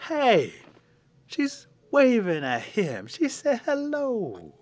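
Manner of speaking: high, slow, drawn-out excited cry; excited warriors. Acted. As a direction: gasp it out like thrilled young warriors. Warriors cheering her attention.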